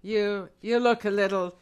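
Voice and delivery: low villain with a Southern lilt; failed German, comes out Southern. Acted. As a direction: botch a German accent into Southern. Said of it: "I know it's Southern".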